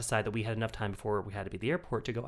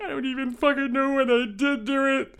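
high, loud exasperated whine; mock drunken yell; 7 dB louder than usual. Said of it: "I'm like".

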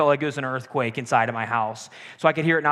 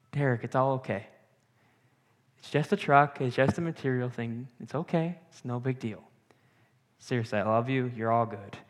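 softer, calm brotherly comfort; gentle reassurance; 3 semitones lower, 5 dB quieter than usual. Retold or imitated. Older brother softly comforting Derek after the crash, tender reassurance. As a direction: soothe him, the truck doesn't matter.

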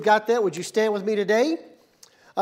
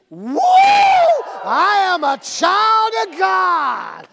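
whoop, then very drawn-out high proclamation; loud, slow wrestler-style declaration; rallying group shout; 10 semitones higher, 7 dB louder, much slower than usual. Shown as a demonstration. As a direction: belt it out, stretching every word for the crowd. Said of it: "Let's try it one more time".

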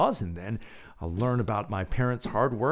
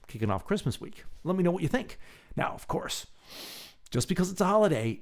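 ordinary talk

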